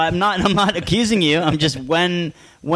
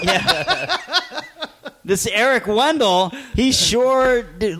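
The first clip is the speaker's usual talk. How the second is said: high drawn-out mock clerk voice; 9 semitones higher, much slower than usual. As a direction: play the dumb clerk, slow and high. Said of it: "and be like".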